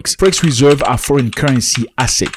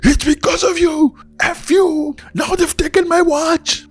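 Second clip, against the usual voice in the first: mock angry scream. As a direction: imitate him blaming you in a shrill mock yell. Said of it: "This Nigerian guy screaming at me".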